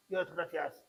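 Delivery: soft, low, listless mock gratitude; unenthusiastic thanks. Shown as a demonstration. Preacher shows the congregation empty thanks, listless demo. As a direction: give thanks with no heart in it. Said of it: "y estoy así".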